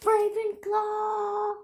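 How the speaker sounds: high, slow excited cheer. Real, not acted. Six-year-old Isaac answers mom with a happy cheer. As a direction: shout it out like a proud fan.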